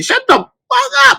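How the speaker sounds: high, fed-up snap at the screen; mock outburst at a TV character; exasperated telling-off. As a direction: tell the TV character to shut up. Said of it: "I be finding myself saying".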